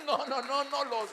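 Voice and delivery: high, very loud refusal; loud defiant refusal. Acted. Preacher voicing a believer who won't leave empty-handed, shouting. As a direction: refuse it with all your strength.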